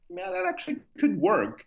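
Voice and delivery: high-pitched upbeat quote of oneself; enthusiastic reply. Retold or imitated. Founder recalling his reply to a friend's shoe idea, high and keen. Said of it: "And I'm like".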